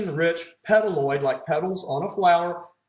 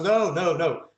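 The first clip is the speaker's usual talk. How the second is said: usual speaking voice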